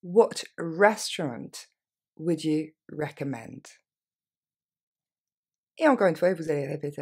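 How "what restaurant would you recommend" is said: very slow, drawn-out question; more slowly. Shown as a demonstration. Teacher to learners, very slow model question. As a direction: stretch every word so learners can follow. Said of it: "Un peu plus lentement".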